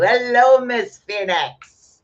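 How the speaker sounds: bright, loud, drawn-out hello to a viewer; cheery greeting. Real, not acted. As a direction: sing out a welcome to a viewer.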